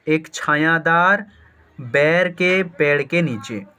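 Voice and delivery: normal talking voice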